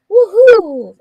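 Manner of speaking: high, loud whoop; excited cheer. Real, not acted. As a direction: let out a big, drawn-out cheer. Cheering a viewer's comment.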